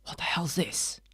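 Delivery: hushed, stunned whisper; shocked whisper. Retold or imitated. Kid seeing the ruined film, stunned whisper. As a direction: breathe it out in disbelief. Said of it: "And you're like".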